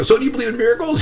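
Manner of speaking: high-pitched, overcome re-enactment; retold tearful joy. Patient asking his doctor after good news, high and tearful. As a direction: relive the happy crying in a high voice. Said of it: "I'm crying. Everybody's crying".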